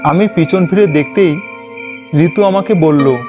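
plain reading voice

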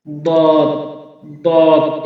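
দত from the tongue's side against the molar gums; letter articulation demo. Shown as a demonstration. Teacher models for practice, দত with tongue edge on molars. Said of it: "এভাবে আমরা উচ্চারণ করব".

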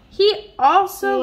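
high, slow, lifted; reading to a child with building excitement; excited. Acted. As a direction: stretch it out with excitement.

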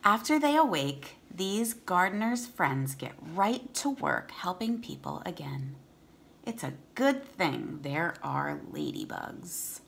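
clear, steady read-aloud voice; storybook reading for kids; reading to children. Acted.

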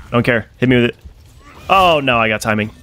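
ordinary talk

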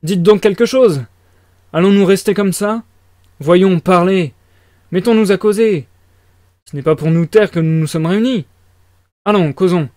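insistent loud pleading; urgent shouting. A narrator's reading. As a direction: rouse them all loudly. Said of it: "cria-t-il brusquement".

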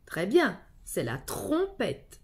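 ordinary delivery

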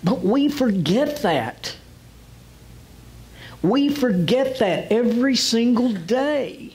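high, slow exhortation; impassioned preaching. Real, not acted. Preacher admonishing Christians about lost authority, impassioned preaching. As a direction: press each phrase home from the pulpit.

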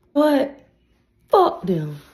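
ordinary delivery

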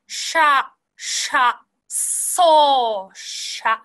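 "シャ" and "ソー" with long released sh and s; breathy consonant onsets. Shown as a demonstration. Teacher modelling the syllables, "シャ" and "ソー" separately with airy onsets. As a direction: lead "シャ" and "ソー" with flowing air. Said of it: "みたいな感じです".

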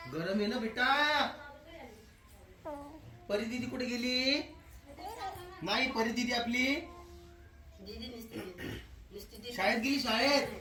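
high, rising questions to a little one; baby talk. Acted. Father asking his toddler where big sister went, high lilt. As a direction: ask it in a bright childish lilt.

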